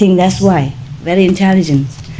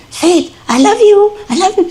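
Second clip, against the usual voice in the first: high coaxing voice for a dog; encouraging; 13 semitones higher than usual. Retold or imitated. Owner coaxing dog to speak, encouraging. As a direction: coax a pet in a much higher voice. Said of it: "I keep trying to encourage her".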